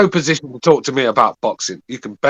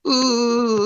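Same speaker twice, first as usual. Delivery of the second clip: mock whine; 11 semitones higher, 3 dB quieter than usual. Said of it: "Whining and bitching and moaning".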